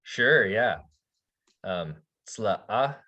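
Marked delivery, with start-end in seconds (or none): 2.3-3.0 creaky glottal "sla-a" with a catch before the vowel; glottalized syllable. Shown as a demonstration. Teacher modelling "sla-a" for students, creaky glottal catch. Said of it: "Kind of that".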